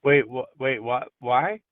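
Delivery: loud, baffled interruption; can't believe what she hears; incredulous. Real, not acted. Reacting to surgery suggestion.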